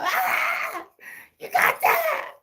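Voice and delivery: mock the thrilled friends; hyped-up squeal of approval; excited friends. Shooter friends cheering.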